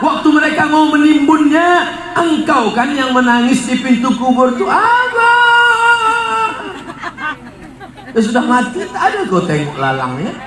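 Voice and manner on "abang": a hammed-up widow crying out for her husband; mock wail. Preacher mocks a wailing widow at the grave, sung wail.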